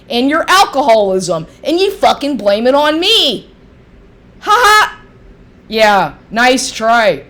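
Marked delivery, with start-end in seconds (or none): 4.4-5.0 mocking laugh. Real, not acted.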